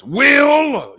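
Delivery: huge high shout of Will; booming James Avery. Retold or imitated. TV dad bellowing at his nephew, big booming call. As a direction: boom the name like a stern TV dad calling his son. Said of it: "use that big boisterous voice".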